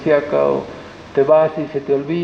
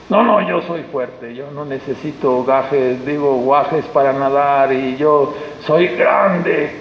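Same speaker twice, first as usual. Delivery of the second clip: boastful refusal; 4 semitones lower, slower than usual. Retold or imitated. Voices a boastful sinner.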